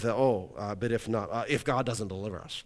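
low, quiet puzzling aloud; musing translators. Acted. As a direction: think it through under your breath, offhand. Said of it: "In their mind, they read that".

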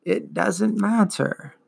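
put-on slow, weary old creature voice; ancient turtle creature. A narrator's reading. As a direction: drag each word like an ancient beast. Morla voiced.

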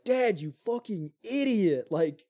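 high, slow exasperated rant at a foolish father; angry son. Retold or imitated. Son yelling at his dad over the gambling deal, high and slow. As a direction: drag it out in disbelief, voice up high.

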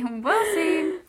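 trying not to laugh. Real, not acted. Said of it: "You gotta stop making me laugh".

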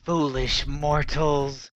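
slow, snarling spectral voice; snarling ghost elf. Acted. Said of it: "she snarls".

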